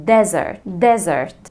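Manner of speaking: "Desert" with a strong stress on DE; first-syllable stress. Shown as a demonstration. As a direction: lean hard on the DE of "desert". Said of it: "فين ال stress". (النبر على المقطع الأول في "desert")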